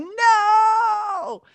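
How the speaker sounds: loud high shout of protest. Retold or imitated. Host wanting to stop girls swooning, loud high shout. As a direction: yell it as if to stop the scene. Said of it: "run around going".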